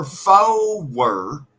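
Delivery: explaining the accent, four stretched into "four-wer"; two-syllable four. Shown as a demonstration. Teaching regional speech, "four" as two-syllable FOH-wer. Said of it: "but we say forward".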